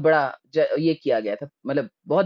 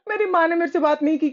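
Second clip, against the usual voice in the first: laughing through tears; 10 semitones higher than usual. Real, not acted. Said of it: "laughing-crying retelling".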